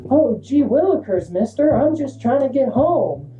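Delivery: put-on high innocent aw-shucks kid voice; wholesome 1950s kid. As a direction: play the squeaky-clean good boy. Acting innocent for the cop.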